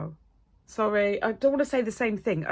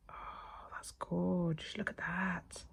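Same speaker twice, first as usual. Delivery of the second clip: soft, hushed admiration; breath-held marvelling; awed whisper; 12 dB quieter, slower than usual. Real, not acted. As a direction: whisper it as if spellbound. Hushed awe at the glitter.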